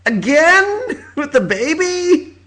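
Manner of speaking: high, laughing disbelief; incredulous laugh. Retold or imitated. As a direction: squeal it out in amused shock. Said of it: "I was like".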